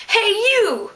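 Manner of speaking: hey you called out high; calling out. Shown as a demonstration. Teacher demos calling someone over. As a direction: shout it across the room.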